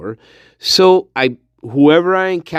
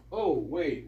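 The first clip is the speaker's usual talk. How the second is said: usual speaking voice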